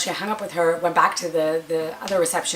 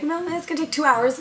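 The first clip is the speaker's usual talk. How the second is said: lightly put-on high receptionist voice; high, breezy front-desk tone; 10 semitones higher than usual. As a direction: mimic the dismissive receptionist, higher.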